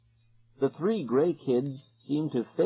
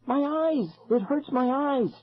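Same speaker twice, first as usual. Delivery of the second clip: pained cry; 13 semitones higher than usual. A narrator's reading. Kids hurt by the light.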